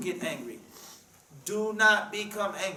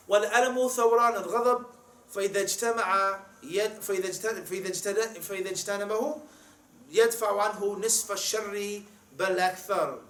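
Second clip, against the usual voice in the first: rapid Arabic recitation; 2 semitones higher, much faster than usual. Shown as a demonstration. Preacher quoting a scholar to the congregation, in a fast recitation. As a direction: rattle off the Arabic quotation at speed.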